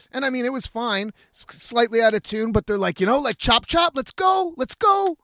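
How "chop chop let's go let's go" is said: high, louder mock chop-chop; hammed-up hurry-up voice; hurrying crew. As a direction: rush him like an impatient crew boss.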